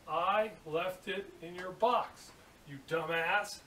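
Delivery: slow, emphatic comeback; mock-scornful punchline. Apprentice's retort to the steel worker, slow and scornful. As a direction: spell it out for a fool.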